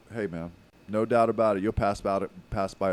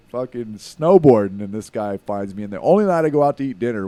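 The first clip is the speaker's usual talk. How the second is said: amazed emphasis; 3 semitones higher, 10 dB louder than usual. Real, not acted. Amazed retelling of fan encounter.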